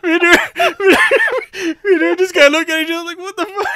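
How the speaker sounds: laughing through the words, high and loud; can barely get it out for laughing. Real, not acted.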